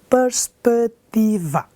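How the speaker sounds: perspectiva slowly broken into separate syllables. Shown as a demonstration. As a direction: say perspectiva as per-spec-ti-va, a beat on each. Exemplo de divisão em sílabas. (perspectiva, sílaba por sílaba, devagar)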